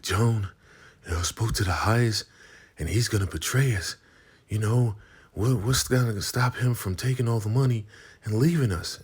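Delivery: high, fast, panicky voice; scared River. Retold or imitated. Scared River pleading with Joan, high and panicky. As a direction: fret nervously, words rushing out. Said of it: "He's like".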